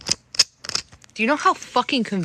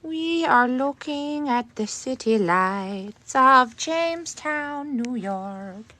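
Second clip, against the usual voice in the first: slow, higher presenter voice; slow tour guide; 5 semitones higher, much slower than usual. Acted. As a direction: narrate the view like a travel host. Mock tour narration.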